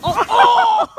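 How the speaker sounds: yelp, then break up laughing; laughing shout. Real, not acted. Friend crashes on sled, laughing.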